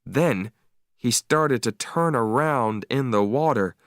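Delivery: ordinary delivery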